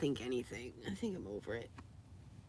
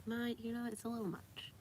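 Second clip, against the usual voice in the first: voicing the pet; 6 semitones higher, 6 dB quieter than usual. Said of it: "He's like".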